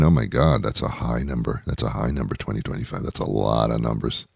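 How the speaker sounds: low, soft aside; hushed mock awe. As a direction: drop right down, as if overwhelmed.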